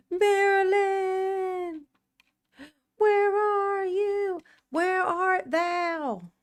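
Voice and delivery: high, slow theatrical call. Pretend. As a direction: sing out her name slowly. Calling out for Marilyn.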